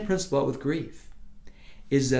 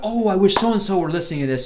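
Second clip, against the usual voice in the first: raised, louder mock inner voice; imagined inner wish; 8 semitones higher, 9 dB louder than usual. Preacher voices a listener's wandering thought, louder and higher. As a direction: blurt the wish out loud and high. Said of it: "you're already thinking about".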